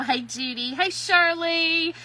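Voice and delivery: normal talking voice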